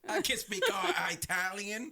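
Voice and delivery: high, slow, laughing mock Italian; mock Italian boast. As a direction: parody a clueless Italian-speaking boast. Listener joins mocking the accent boast.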